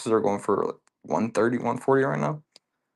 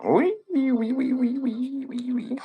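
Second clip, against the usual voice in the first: high-pitched mock French oui oui; squeaky cartoon Frenchman; 13 semitones higher, slower than usual. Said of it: "I don't know what language I just spoke in. Probably French".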